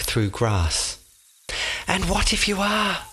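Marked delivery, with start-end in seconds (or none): 1.9-3.0 mildly worried call. A narrator's reading. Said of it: "she called after him, worried".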